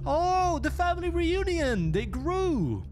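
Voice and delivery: very high-pitched gushing squeal; exaggerated high excitement; mock delight. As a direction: coo high over a family reunion. Mock delight at more ghosts appearing.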